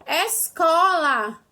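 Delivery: "Escola" said very slowly and clearly. Shown as a demonstration. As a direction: stretch "Escola" out for the class. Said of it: "trissílaba". ("Escola" bem devagar)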